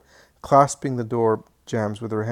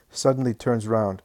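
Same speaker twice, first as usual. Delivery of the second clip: ordinary delivery, nothing marked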